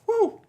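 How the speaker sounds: high joyful woo; excited whoop. Real, not acted. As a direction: whoop in triumph. Celebrating the accent.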